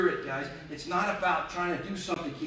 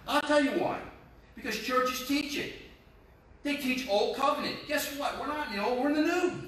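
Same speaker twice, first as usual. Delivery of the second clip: high-pitched, urgent pulpit exhortation; impassioned preaching; 7 semitones higher than usual. Acted. Pastor challenges church on old covenant, impassioned preaching. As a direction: build the challenge, pitched well up.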